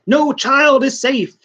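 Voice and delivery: high, slow menacing demon line; hammed-up ominous demon; mock demon threat. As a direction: draw out the threat like a ham villain. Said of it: "the demon says".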